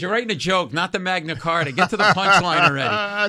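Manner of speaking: loud, high hectoring complaint; mocking. Comic as Leno demanding a punchline, loud mocking. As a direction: berate him in hammed-up exasperation. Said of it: "he starts making fun of me".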